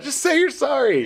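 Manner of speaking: put-on high, pleading outburst at a toddler; retold exasperation. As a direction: re-enact a fed-up parent, pitch leaping up. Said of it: "I was so mad".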